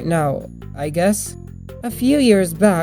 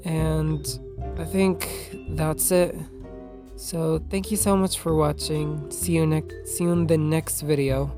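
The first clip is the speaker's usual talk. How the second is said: shy; 7 dB quieter than usual. Real, not acted. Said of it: "I sounded a little bit shy or weird".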